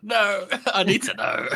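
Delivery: high-pitched pleading demand, half laughing; mock insistence. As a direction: imitate someone who simply must know. Imitates Friendly insisting on a number.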